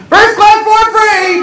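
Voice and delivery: high, loud, drawn-out gloating shout; relieved crowing. Retold or imitated. Upgraded passenger announcing it to coach, jubilant shout. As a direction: crow it slowly to the whole cabin. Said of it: "I'm so relieved I'm back in coach going".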